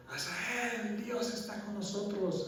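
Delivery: lightly voiced brother calling out; called out. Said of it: "me gritó". (llamado agudo desde lejos, a medias)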